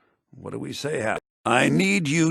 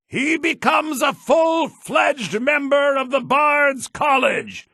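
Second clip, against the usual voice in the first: ceremonial speech; 11 semitones higher, 5 dB louder than usual. Acted. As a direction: address the gathered town at the ceremony. Bard welcomes crowd at the burning.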